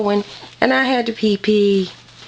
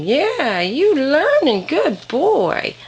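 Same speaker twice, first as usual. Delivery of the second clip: higher, sing-song praise for a good puppy; 7 semitones higher, much slower than usual. Real, not acted. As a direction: gush over the puppy, pitched up.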